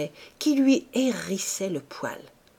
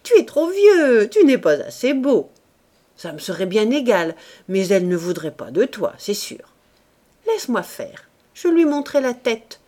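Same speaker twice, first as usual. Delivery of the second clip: giggling wife; 2 semitones higher, 7 dB louder than usual. A narrator's reading. Wife mocking her husband, giggly and loud. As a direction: tease him as if tickled. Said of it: "se mit à rire".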